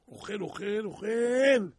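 swelling from spoken to booming; mounting repetition. Retold or imitated. Preacher tells of Pharaoh eating and eating, mounting repetition. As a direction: repeat it, building to a loud, high last word.

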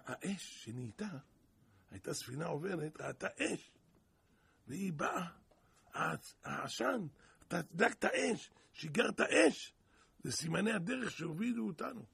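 ordinary talk, nothing marked

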